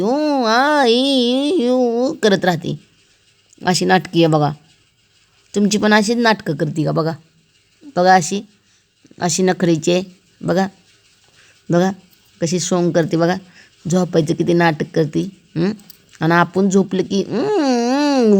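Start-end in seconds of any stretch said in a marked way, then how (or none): 0.0-2.1 high, whiny mock cat grumbling; mimicking the cat's annoyed protest sounds; imitated grumpy cat whine. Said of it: "अशी राग राग करते". 17.2-18.4 high, drawn-out mock cat whine; imitating the cat crying to wake you up; imitated cat whining. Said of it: "वरडत राहून".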